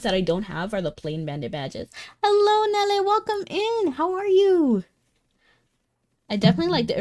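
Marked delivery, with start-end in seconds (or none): 2.2-4.8 high, loud welcome for a viewer; excited greeting. Real, not acted. Streamer greeting viewer Nele joining chat, high and excited. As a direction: throw a big bright hello to the newcomer.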